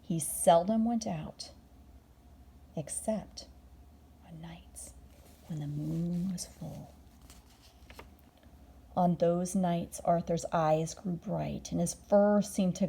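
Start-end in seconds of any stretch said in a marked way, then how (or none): none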